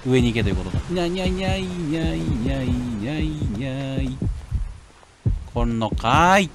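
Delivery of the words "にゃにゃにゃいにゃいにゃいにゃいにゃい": cat-like chant; playful nonsense babble. Real, not acted. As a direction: babble it to yourself. Babbling playfully while dodging.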